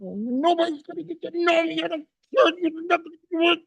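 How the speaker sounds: put-on a pinched, squeaky old miser shutting the world out; defiant Scrooge voice. Scrooge closing himself off from everyone, in a high defiant whine.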